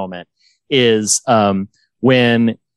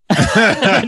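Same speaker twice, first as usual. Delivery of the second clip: short laugh; 10 semitones higher than usual. Real, not acted. Laughing at the joke.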